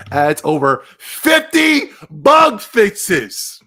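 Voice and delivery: high, loud and drawn out; loud mock amazement. Real, not acted. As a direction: blurt it out loudly, hitting every word. Mocking a game update headline.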